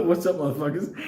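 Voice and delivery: voicing the animal through a laugh; cocky hello, cracking up; laughing animal greeting.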